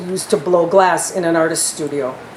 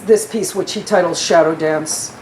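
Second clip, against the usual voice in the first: normal talking voice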